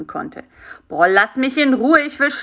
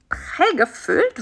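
puzzled surprise; 8 semitones higher, slower than usual. Acted. Fly puzzled by stuffed slippers.

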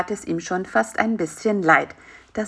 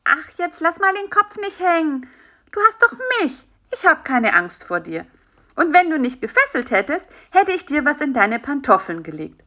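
higher, lightly voiced fly reassuring; comforting fly; 7 semitones higher than usual. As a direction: cheer up a lonely friend. Narrator voicing Bisi comforting.

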